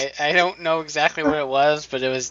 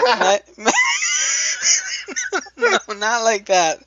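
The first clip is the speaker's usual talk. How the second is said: laughing; 9 semitones higher than usual. Real, not acted. Reader cracking up over a botched word, laughing. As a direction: stammer into helpless laughter. Cracks up while reading a name.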